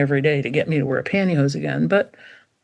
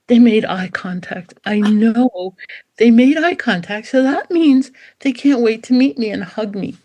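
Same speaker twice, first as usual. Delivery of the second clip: higher, giddy puppy thrilled to be noticed; acted breathless delight, pitch climbing; overexcited puppy; 4 semitones higher than usual. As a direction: gush like a puppy desperate for hugs.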